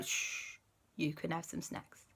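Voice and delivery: soft unvoiced shush, then quiet; hushing. The boys hush Owen and promise snacks, soft. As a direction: hush the child gently.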